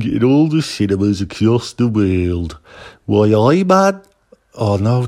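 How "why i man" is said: mock Geordie with a bit of Birmingham, rising on man; botched Geordie impression; Geordie accent. Guest trying a Geordie accent for listeners. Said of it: "that was Geordie with a bit of Birmingham".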